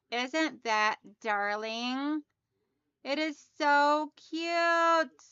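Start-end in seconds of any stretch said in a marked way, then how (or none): 3.0-5.2 slow, drawn-out admiring coo; slow fond gush. Real, not acted. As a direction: linger lovingly on the cute. Gushing over her new candle.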